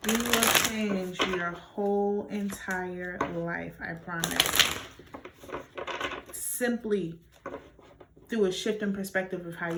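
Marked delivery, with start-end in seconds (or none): 0.1-4.8 "whole entire life" stretched out slowly; measured, drawn-out assurance; slow, deliberate promise. Real, not acted. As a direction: spell it out slowly, word by word. Deliberate slowed repeat as a promise.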